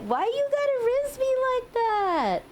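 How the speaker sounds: high, squeaky mock-bashful protest; flustered squeal. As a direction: squeal like you're blushing. Flustered by viewer's compliment.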